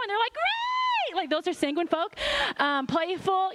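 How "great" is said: "Great!" squealed way up high; ecstatic "Great!". Retold or imitated. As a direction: burst out like the happiest person alive. Sanguine friends' answer.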